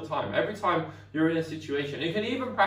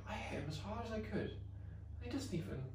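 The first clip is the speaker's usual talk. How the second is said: soft, higher disbelieving mutter; baffled she didn't hurt him; hushed amazed aside; mock puzzled bully; 7 semitones higher, 15 dB quieter than usual. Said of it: "And she's like".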